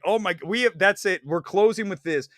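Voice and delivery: ordinary delivery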